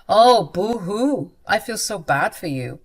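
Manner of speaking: slow, loud mock whimper; mocking boo-hoo. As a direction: sneer at his self-pity. Wife mocks husband's self-pity.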